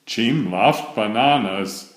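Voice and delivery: ordinary delivery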